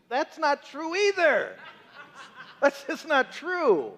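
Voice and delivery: high, slow, exasperated disbelief; incredulous. Acted. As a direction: stretch it out like it's absurd. Repeating debunked myth, exasperated.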